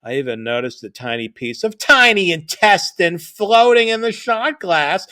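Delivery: usual speaking voice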